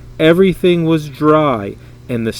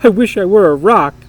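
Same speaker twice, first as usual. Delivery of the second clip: louder, higher blurt of panic; a young donkey's frantic, raised wish; panicked; 4 semitones higher, 8 dB louder than usual. Acted. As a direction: cry it out in a sudden fright. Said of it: "he panicked and couldn't think".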